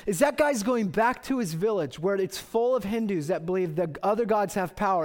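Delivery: normal talking voice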